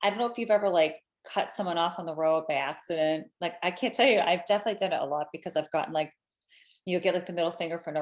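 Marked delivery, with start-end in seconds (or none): none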